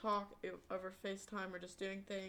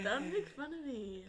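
laughing; 6 semitones higher, 3 dB louder than usual. Real, not acted. Sister teased about her voice.

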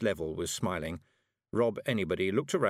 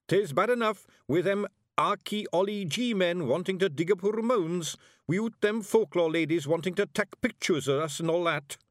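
high, loud Scottish little-man voice; grumbling Scots warrior; 10 semitones higher, 5 dB louder than usual. A narrator's reading. Tiny warrior grumbles about nosy visitors, feisty Scots. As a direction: complain energetically in broad Scots.

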